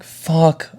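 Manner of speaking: high-pitched mock dread; dismayed curse. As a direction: curse like you've just been caught. Dreads being called to office.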